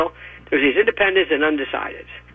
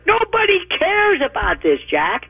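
high exasperated outburst; 10 semitones higher than usual. Real, not acted. Exasperated dismissal.

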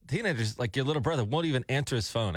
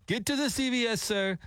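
high, imitated non-American accent, scammer on the phone; 12 semitones higher than usual. Host imitating the scam caller's non-American accent on air. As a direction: mimic the scammer's foreign accent, pitched up. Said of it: "he doesn't have an Americanized accent".

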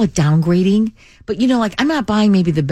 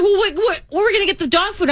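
much higher, fast, worried mock whine; imitating daughter's panic; 11 semitones higher, faster than usual. Imitating daughter asking where the dog food comes from, high and rushed. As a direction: rattle it off high like a frantic kid. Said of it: "And my daughter's like".

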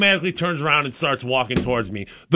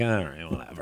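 unimpressed shrug; 9 semitones lower, 11 dB quieter than usual. Retold or imitated. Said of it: "it's always been like".

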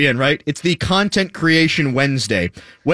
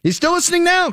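normal talking voice

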